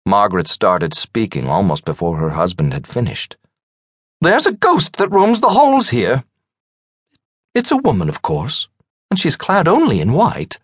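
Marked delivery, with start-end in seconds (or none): 4.2-10.6 much higher woman's voice; high, eager gossip relishing a ghost tale; eager storytelling hostess. A narrator's reading. Wife telling dinner guests a ghost tale, high and eager.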